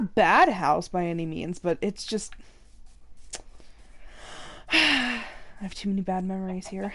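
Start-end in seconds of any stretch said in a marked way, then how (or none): none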